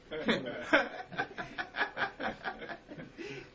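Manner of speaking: airy, near-soundless laugh that goes on a while; cracking up at his own boast; long silent laugh. Real, not acted. Speaker cracks up at his own words.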